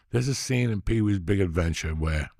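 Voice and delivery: ordinary delivery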